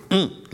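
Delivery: high, punched preacher's "mm"; revivalist preacher's grunt. Retold or imitated. Pastor imitating the preachers he grew up with, high punched grunt. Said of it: "revivalistic atmosphere where the preachers were".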